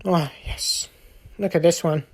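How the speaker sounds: breathy awed whisper, nearly voiceless. Real, not acted. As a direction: hush it like a secret find. Excited whisper over new Pokemon.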